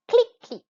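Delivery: high, loud click; teacher imitating a camera in the review; camera click imitation. Shown as a demonstration. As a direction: snap it out like a camera shutter. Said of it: "one more time".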